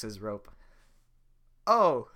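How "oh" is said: loud high yelp; Donkey jerked; pained yelp. Acted. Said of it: "says donkey".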